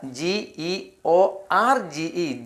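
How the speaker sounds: slow spelling. Shown as a demonstration. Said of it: "teacher spelling slowly".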